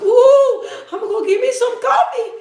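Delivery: high-pitched, squealed act-out; mock excitement. Streamer acting out going for coffee, high mock excitement. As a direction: let it shoot up high and playful.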